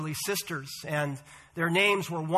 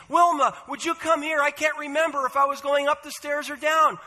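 calling out; 6 semitones higher than usual. Acted. Calling her sister downstairs.

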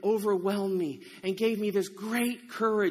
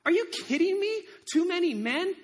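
high, louder protest of disbelief; incredulous; 8 semitones higher, 4 dB louder than usual. Acted. Gideon protesting to God about too few troops, incredulous. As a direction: throw it back as an outraged question.

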